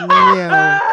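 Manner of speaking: high, loud laughing meow; playful meow at F1. Pretend.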